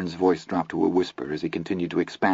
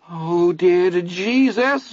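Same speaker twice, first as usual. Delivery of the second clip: much higher, slow teary whimper; tearful whimper; 10 semitones higher, 3 dB louder, much slower than usual. A narrator's reading. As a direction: whimper close to tears. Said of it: "Vern whimpered, his voice high and filled with tears".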